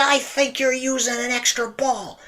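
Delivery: put-on high-pitched boyish accusation; high young voice; young self. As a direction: pipe up like a suspicious kid. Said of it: "I said".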